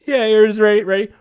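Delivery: usual speaking voice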